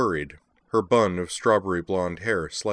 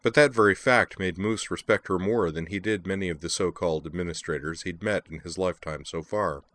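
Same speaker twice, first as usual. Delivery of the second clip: ordinary delivery, nothing marked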